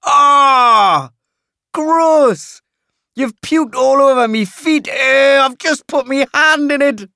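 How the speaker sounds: high Geordie yell of revulsion; disgusted Geordie outburst, very loud. A narrator's reading. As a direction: shriek it, utterly grossed out. Paulie disgusted by vomit.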